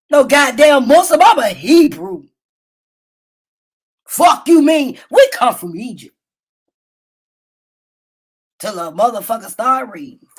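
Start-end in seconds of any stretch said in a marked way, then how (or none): none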